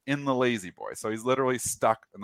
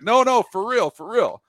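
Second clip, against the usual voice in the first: higher, louder pleading insistence; urgent insistence; 10 semitones higher, 5 dB louder than usual. Retold or imitated. Friend on the phone insists to the 911 operator, loud and urgent. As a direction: beg them to believe you. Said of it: "He's like".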